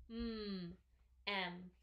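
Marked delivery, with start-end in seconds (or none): 0.1-0.7 "Mm" as a held hum; isolated m sound. Shown as a demonstration. Teacher finding the first letter, held "Mm". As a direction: hum "Mm" with no vowel after it. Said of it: "the letter that makes the sound".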